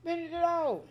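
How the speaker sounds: high-pitched through sour taste; high excited squeal. Real, not acted. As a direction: squeal while chewing sour candy. Reacting to sour candy.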